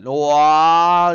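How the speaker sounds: long, loud "wow"; asombro exagerado. Real, not acted. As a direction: draw out a big loud wow. Asombro por la palabra difícil. (asombro fuerte y alargado)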